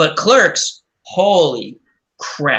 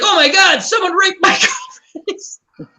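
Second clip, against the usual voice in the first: high, alarmed quoted cry; high, lightly hammed-up panic; mock shocked outcry; 13 semitones higher than usual. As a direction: play the horrified boyfriend, laughing through it. Retold character's shocked outcry.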